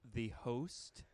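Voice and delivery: British accent model phrase; British elocution. Shown as a demonstration. Host leading a mock lesson, British elocution. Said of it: "Okay, try this".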